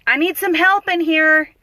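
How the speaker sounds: high, loud call down the hall; calling for help. Retold or imitated. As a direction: imitate a nurse summoning help.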